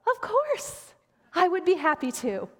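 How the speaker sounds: very high, chirpy put-on willingness; over-eager yes. Pastor agreeing to preach for a sick friend, over-eager. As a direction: leap up high with mock eagerness, then ease down. Acted overly willing reply.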